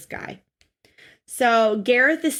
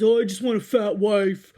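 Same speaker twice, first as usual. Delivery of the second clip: put-on dopey whine of a selfish husband; mock him, repeating it in a put-on voice; mocking impression of Gareth. Podcaster ridiculing a husband's selfish wish, mocking put-on voice. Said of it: "That's literally what he sounds like".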